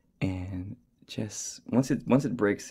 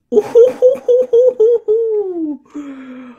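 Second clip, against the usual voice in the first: very high, loud laughing shriek; real shocked laugh; 13 dB louder than usual. Real, not acted. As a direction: burst into a screaming laugh. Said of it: "that was a real reaction".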